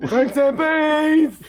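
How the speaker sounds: very high, loud, stretched-out shout; hammed-up slow high yell; movie-quote holler. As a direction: holler it like a silly film quote. Quoting comedy film scene.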